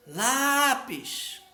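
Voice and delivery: LÁÁÁ-pis, agudo; penúltima sílaba forte. Shown as a demonstration. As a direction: chame Lápis esticando o LÁ. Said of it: "a penúltima sílaba é a mais forte". (Lápis com LÁ agudo e demorado)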